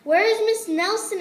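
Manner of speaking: high, loud worried question; worried kids. Acted. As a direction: call out in alarm. Kids ask, alarmed.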